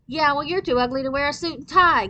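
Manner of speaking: put-on kid's angry comeback; snapped. A narrator's reading. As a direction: snap back at her. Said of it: "snapped Gabriel".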